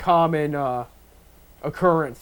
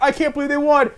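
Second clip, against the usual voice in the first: excited disbelief; 9 semitones higher, 3 dB louder than usual. Retold or imitated. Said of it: "I went".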